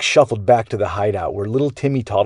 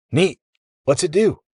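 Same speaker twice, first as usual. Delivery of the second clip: put-on bossy kid; 8 semitones higher than usual. A narrator's reading. Jax grabbing gear.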